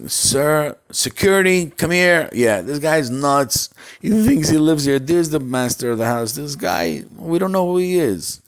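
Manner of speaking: loud, high servant calling for guards; calling security. Acted. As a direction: call security on the intruder. Butlers call security.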